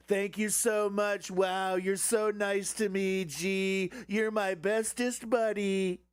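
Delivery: high, slow fawning Trump voice; Trump impression. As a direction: simper like a starstruck Trump. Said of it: "Donald Trump said".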